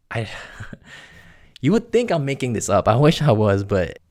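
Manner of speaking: ordinary talk, nothing marked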